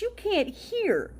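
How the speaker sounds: sneering whisper, barely voiced; mocking whisper. Mocks the excuse again, whispered.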